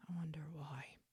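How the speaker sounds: whispered dry aside, under the breath; sarcastic whisper. Real, not acted. Whispering a snide aside about his texts. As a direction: breathe it out wryly as a jab.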